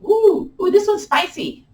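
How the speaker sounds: high-pitched reaction to the burn; high, playful ooh of strain; voice going up. Real, not acted. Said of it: "when my voice goes up".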